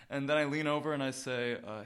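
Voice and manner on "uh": usual speaking voice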